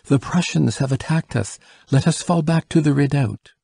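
high, urgent battlefield report; breathless report. A narrator's reading. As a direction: deliver the alarm as a winded runner. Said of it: "out of breath".

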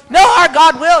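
high, loud defiant cry; the prophets shouting back, indignant; indignant protest. Retold or imitated. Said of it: "they're like".

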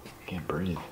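real nervous near-whisper, soft and short of breath; quiet, nervous aside to yourself; talking too low. Real, not acted. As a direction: drop to an under-the-breath murmur, nervously. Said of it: "I'm talking too low".

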